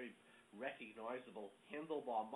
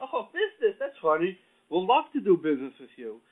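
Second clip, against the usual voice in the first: loud, high, gleeful reaction; mock enthusiasm; 8 semitones higher, 17 dB louder than usual. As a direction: pounce on the offer in a big voice. Young men jump at business talk.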